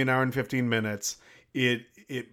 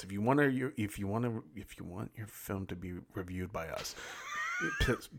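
stage whisper; 3 semitones lower, 7 dB quieter than usual. Acted. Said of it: "Dan, do a stage whisper".

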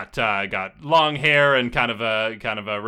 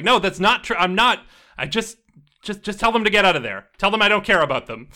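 high, louder flustered protest; Savitri; 8 semitones higher, 5 dB louder than usual. Acted. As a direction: deny any concern, voice pitched up. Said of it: "And Savitri's like".

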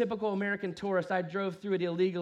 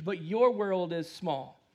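ordinary talk, nothing marked

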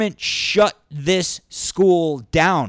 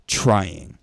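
ordinary talk, nothing marked

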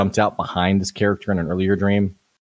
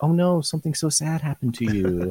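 high sympathetic voice; 6 semitones higher than usual. Retold or imitated. Party mock-sympathizing with Erica.

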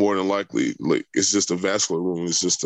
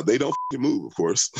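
ordinary delivery

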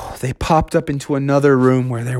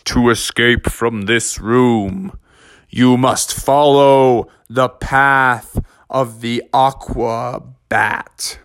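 slow, drawn-out mysterious voice; a riddle spoken from the dark, slowly; slow cave voice; 3 semitones lower, slower than usual. A narrator's reading. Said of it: "And the voice said".

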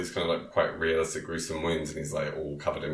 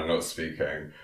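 ordinary delivery

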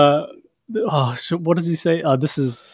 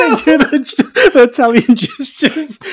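slow, high words through laughter; laughing while talking; 10 semitones higher, 5 dB louder, slower than usual. Real, not acted. Recalling the movie scene, laughing through the words. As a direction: squeeze the words out while cracking up. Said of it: "I couldn't stop laughing".

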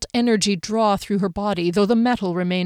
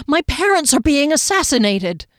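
higher, outraged protest; flaring rage; 6 semitones higher, 4 dB louder than usual. A narrator's reading. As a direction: protest in raised, angry voice. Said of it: "rage flared".

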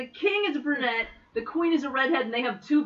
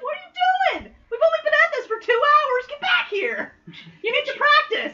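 bossy warrior woman; 5 dB louder than usual. Retold or imitated. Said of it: "She's like".

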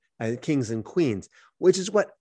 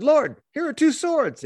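high-pitched, drawn-out eager announcement; acted naive enthusiasm, high and stretched; eager disciples; 13 semitones higher, 4 dB louder, slower than usual. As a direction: proudly present it, pitched way up. Disciples eagerly present two swords.

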